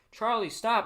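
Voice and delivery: loud, high call after a runaway dog. Retold or imitated. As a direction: shout it after the dog as the mom. Mom chasing the runaway dog.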